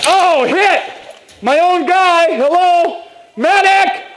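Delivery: high calls for a medic; man down shout. Real, not acted. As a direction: call out for help after a hit. Hit, calling medic.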